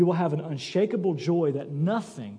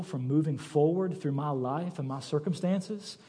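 calm Paul; 4 semitones lower, 7 dB quieter than usual. Retold or imitated. Paul in prison telling friends his soul is whole, soft and calm. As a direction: let each line rest quietly.

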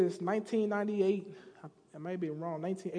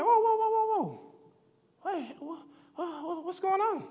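sudden much higher "whoa"s, stammering into a confused question; startled older son; 9 semitones higher than usual. Retold or imitated. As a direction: caught off guard by the noise of a party. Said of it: "He's like".

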